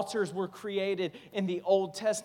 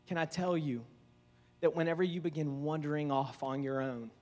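usual speaking voice

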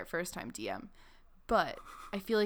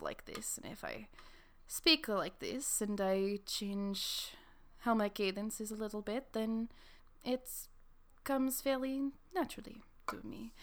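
Trianola; 3 semitones higher than usual. Shown as a demonstration. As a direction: stretch the words in a measured character cadence. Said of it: "in my character voice".

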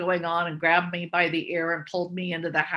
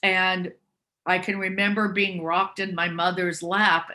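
usual speaking voice

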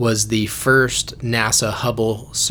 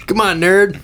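teasing; 8 semitones higher, 5 dB louder than usual. Real, not acted. Teasing, raised voice.

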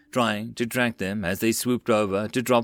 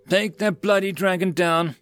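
higher-pitched angry order; angry yell; 7 semitones higher than usual. A narrator's reading. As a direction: yell angrily into an intercom. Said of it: "Quark yelled angrily into his intercom".

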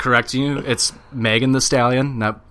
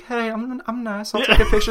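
sweet seal voiceover; 9 semitones higher than usual. Retold or imitated. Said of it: "He's like".

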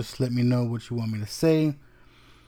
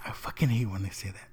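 quick, quiet annoyed grumble; 3 semitones lower, 7 dB quieter, faster than usual. Retold or imitated. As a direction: rush it under your breath, irritated. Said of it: "you can tell he wanted to say".

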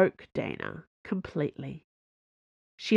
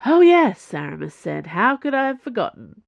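sarcastic; 4 semitones higher, 5 dB louder than usual. Acted. Sarcastic teasing of Athos.

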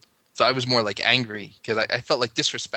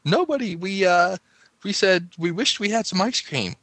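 put-on much higher, louder childish denial; imitating lying kid; 9 semitones higher, 4 dB louder than usual. Boy caught lying to parent at night, high childish denial. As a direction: squeak out a kid's fib. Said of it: "I said".

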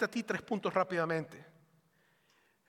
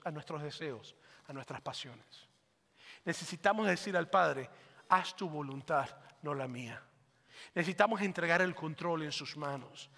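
lower, much softer closing appeal; soft altar appeal; 5 semitones lower, 5 dB quieter than usual. Acted. Pastor closing the sermon to the congregation, soft appeal. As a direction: bring it down to a gentle plea.

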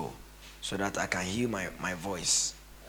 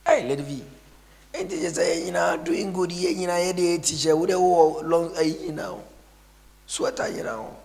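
put-on higher, cocky young guy voice; mock youth slang; 8 semitones higher, 6 dB louder than usual. Pastor imitating a youngster greeting Lady V, higher. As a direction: play a swaggering teenager.

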